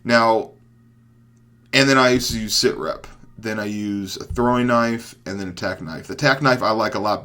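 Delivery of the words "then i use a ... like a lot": ordinary talk, nothing marked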